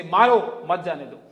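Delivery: "Maro" hit hard and loud, the line slow and irritated; bad-day delivery. Shown as a demonstration. Speaker after a fight with his girlfriend, loud and annoyed. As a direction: snap "Maro mat jane do" out loud, with no pause after "mat". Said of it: "having a bad day".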